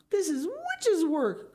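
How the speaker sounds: husband crying out about the witchcraft; cried out. Acted. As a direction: proclaim it in alarm. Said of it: "cried Mister Gregg".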